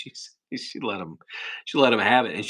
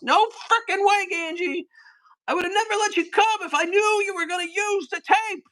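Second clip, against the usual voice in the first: much higher, louder mock screaming rant; Scott the Engineer impression; 3 dB louder than usual. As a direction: shriek at Ganji like the furious engineer. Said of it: "that's my Scott the Engineer impression".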